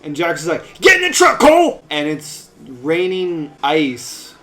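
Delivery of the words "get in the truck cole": high, very loud mock yell; urgent shout. Jax ordering Cole to flee the raining ice, urgent shout. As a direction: bellow it as an emergency order.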